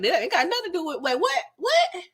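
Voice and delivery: high, squeaky mock confusion; imitating stunned confusion in a high squeak; mock disbelief. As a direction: act out baffled shock, voice shooting up. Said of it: "they looking like".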